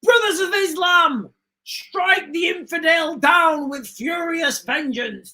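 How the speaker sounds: hammed-up imitation of a fiery call to holy war; mock fanatical cry. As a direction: bellow it like a zealot rallying the faithful. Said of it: "Iran are gonna stand up and say".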